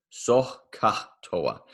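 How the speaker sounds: Soh ka toa with a breathy rasped H in Soh, drawn out slowly; emphasized H's. Shown as a demonstration. Teacher demos the mnemonic to class, leaning on the H's. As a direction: push air on the H in Soh ka toa. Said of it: "I definitely try and emphasize the H's".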